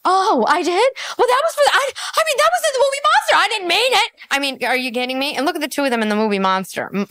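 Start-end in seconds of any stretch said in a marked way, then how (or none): 0.1-4.1 lightly voiced, high and rushed denial; flustered backpedal. Mocking [name]'s flustered backpedal to [name], high and hurried. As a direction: scramble to take it back.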